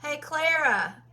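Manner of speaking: loud call across the house. Real, not acted. Mom on a live stream calling her daughter, loud call. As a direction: holler for Clara in the next room.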